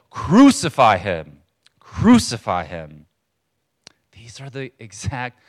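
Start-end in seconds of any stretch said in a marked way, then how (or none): 0.1-3.1 loud, drawn-out mob cry; crowd shouting. Retold or imitated. Preacher voicing the crowd's demand to Pilate, shouting. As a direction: call it out slowly and forcefully, like a mob. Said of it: "they kept shouting all the more".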